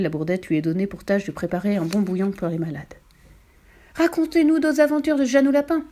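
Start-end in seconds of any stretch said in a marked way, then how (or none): 4.0-5.8 eager, higher-pitched plea; impatient cry. A narrator's reading. Feverish bandits beg the librarian for more stories, impatient cry. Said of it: "Tel était le cri d'impatience".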